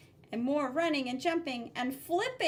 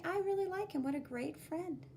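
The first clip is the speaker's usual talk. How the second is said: put-on a touch higher, fond kid's thought; lightly voiced kid; 6 semitones higher than usual. Red kid thinking about her friend, light kid voice. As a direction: think it fondly as a child.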